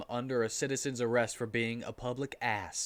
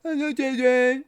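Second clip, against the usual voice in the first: loud, higher goofy greeting; lunch lady voice; 11 semitones higher, 9 dB louder than usual. A narrator's reading. Lunch lady greeting the kids, loud and goofy. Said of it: "said the lunch lady".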